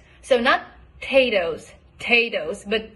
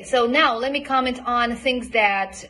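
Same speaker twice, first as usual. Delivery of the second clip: ordinary delivery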